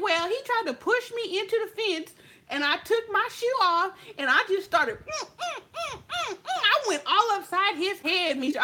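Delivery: put-on high, animated girlfriend voice; friend's excited retelling. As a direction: tell it proud, like a bragging friend. Friend retells beating him.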